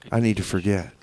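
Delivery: ordinary talk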